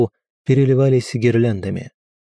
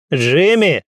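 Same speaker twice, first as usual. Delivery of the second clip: louder, higher call for the son; 6 semitones higher, 4 dB louder than usual. A narrator's reading. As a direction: call the name out louder, worried. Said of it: "на этот раз громче".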